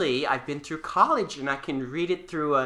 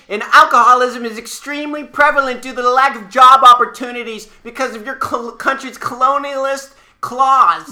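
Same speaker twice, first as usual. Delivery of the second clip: loud, heated lecture; indignant tirade; 6 semitones higher, 9 dB louder than usual. Acted. Co-host blaming the other's country, loud indignation. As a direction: rail at them, slowing on the last words.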